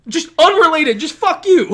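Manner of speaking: loud, high shout. Acted. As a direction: yell it out at the crowd. Wrestler insult acted out.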